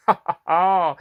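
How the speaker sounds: loud hearty cackle; jolly cackle. Acted. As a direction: chuckle at your own tale. Narrator laughs at farewell.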